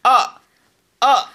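"아" falling sharply from high, punched; Mandarin fourth tone. Shown as a demonstration. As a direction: chop "아" down fast like a karate strike. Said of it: "사성은 격파 동작".

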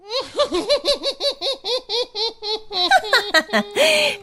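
high scornful laugh; mocking laugh. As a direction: cackle with contempt.

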